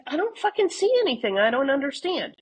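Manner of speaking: high, exasperated protest. Retold or imitated. Answering a friend's photo texts, exasperated and high. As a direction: throw up your hands in disbelief.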